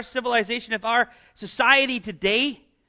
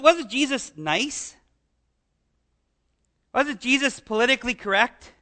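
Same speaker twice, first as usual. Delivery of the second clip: ordinary talk, nothing marked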